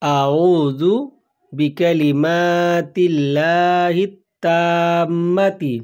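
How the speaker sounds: আউযু বি কালিমাতিল্লাহি তাম্মাতি drawn out slowly, word by word; slow Arabic recitation. Shown as a demonstration. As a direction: recite each word slowly so learners can repeat it. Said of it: "আবার বলছি". (ধীরে ধীরে ভেঙে ভেঙে তিলাওয়াত)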